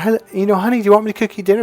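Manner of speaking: high, quick imitation of a cheerful wife; sweetly eager mock wife; happy wife. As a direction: chirp the offer in a put-on wifely voice. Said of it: "she was so happy".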